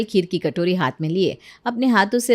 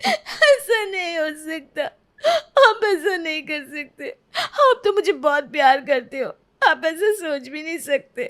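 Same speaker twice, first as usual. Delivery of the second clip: higher, slow sobbing plea; crying disbelief; 5 semitones higher than usual. Acted. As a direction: beg him through tears to say it's a joke. Wife begs husband, heartbroken. (रोते हुए गिड़गिड़ाना)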